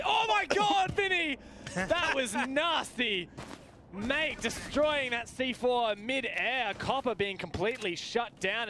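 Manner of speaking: high, loud, thrilled outburst; excited shout. Real, not acted. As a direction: erupt with delight at the play. Caster erupts at great grenade play.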